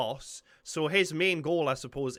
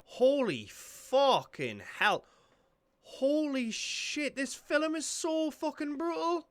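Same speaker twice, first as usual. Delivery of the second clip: slow, drawn-out amazement; stunned awe; 9 semitones higher, 5 dB louder, slower than usual. Real, not acted. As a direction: let the shock sink in slowly. Stunned after brutal fight.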